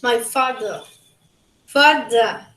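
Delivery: father with no r; "my father, father," as faðə; British father. Shown as a demonstration. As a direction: say "my father, father," with an open ending, no r. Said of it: "In British".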